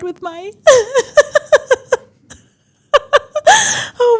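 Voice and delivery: high, teary, half laughing, half crying; laughing through tears. Real, not acted. Closing her story of pelvic pain, laughing through tears.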